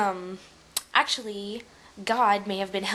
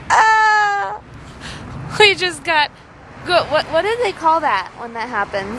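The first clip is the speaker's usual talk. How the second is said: loud high laughing; laughing hard; 10 semitones higher, 8 dB louder than usual. Real, not acted. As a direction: talk through a laughing fit. Laughing fit after joke.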